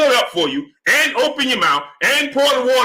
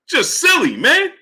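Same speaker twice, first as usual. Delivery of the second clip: exasperated outburst; 5 semitones higher than usual. Real, not acted. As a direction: blurt it out in disbelief. Exasperated rant at chat.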